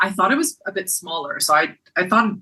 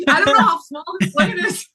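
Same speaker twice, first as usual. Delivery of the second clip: laughing; 9 semitones higher than usual. Real, not acted. Laughing about small plane.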